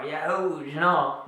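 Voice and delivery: loud jokey bachelor's yahoo; mock whoop. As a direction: give a loud, cheeky cheer. Mock cheer at young ones moving in.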